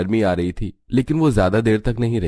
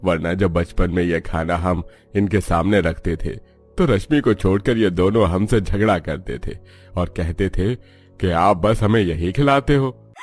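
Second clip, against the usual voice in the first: put-on measured older parent's voice, a little lower; reflective father; 3 semitones lower than usual. Father tells his wife the children have grown, reflectively.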